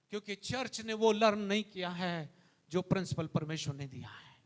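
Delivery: ordinary talk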